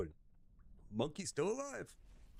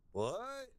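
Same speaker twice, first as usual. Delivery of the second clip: high, startled what; sudden disbelief at the answer; shocked what; 8 semitones higher than usual. Retold or imitated. Hearing the snack is fish eyes, shocked.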